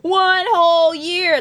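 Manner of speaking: slow, high, hyped emphasis; excited. Real, not acted. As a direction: sell it like a big deal. Hyping the prize.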